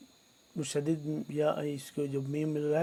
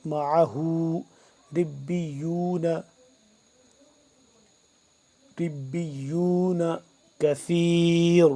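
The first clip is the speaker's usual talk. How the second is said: slow, drawn-out tajweed chanting; measured Quranic recitation; 6 dB louder, slower than usual. Shown as a demonstration. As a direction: recite each word with long held vowels. Teacher recites Quran verse.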